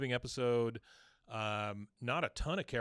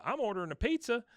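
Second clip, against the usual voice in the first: high, eager little offer; Bill's voice; 10 semitones higher than usual. Retold or imitated. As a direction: chirp it up high. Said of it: "He goes".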